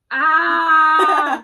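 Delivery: sudden loud cry of pain; pained yell. Real, not acted. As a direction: yelp as the clip bites. Clothespin pinching, real pain.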